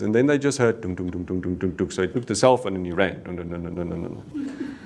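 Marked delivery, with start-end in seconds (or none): 0.9-1.9 low, soft dum dum thuds; imitated thudding. Imitating sounds heard over phone. 3.2-4.8 low, soft dum dum thuds; imitated thudding. Imitating sounds heard over phone.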